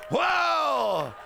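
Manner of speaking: high, loud whoa; mock amazement. Congregation seeing dreams fulfilled, amazed whoa. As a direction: gasp it out amazed. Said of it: "You'll say".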